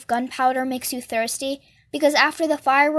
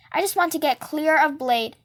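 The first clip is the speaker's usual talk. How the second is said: ordinary delivery, nothing marked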